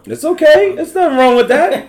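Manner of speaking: high, teasing sing-song reassurance; mock reassuring. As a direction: pretend to soothe him, playfully.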